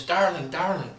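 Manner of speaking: a fussing mother hungry for every detail. Acted. Mother calling her newlywed daughter, high and doting.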